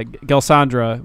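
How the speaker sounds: ordinary delivery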